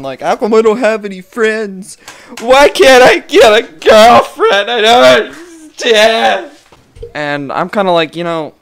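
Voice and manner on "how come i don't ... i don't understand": loud, high whine building to a drawn-out wail; mock internet complainer, wailing slower and louder; mock whining. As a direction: wail it like a hammed-up crybaby. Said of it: "they bitch and complain".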